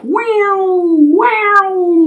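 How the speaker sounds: high, swooping "neeow"; flying zoom noise. A narrator's reading. Goat shakes the spider off, high zooming noise. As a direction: glide it high like something sailing past.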